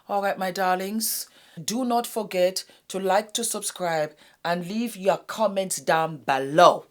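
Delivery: upbeat YouTube goodbye, like and subscribe; vlog sign-off. Acted. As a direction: sign off warmly to your darlings, slowing on the last ask. Channel sign-off to viewers.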